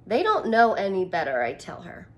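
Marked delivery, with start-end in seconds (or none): none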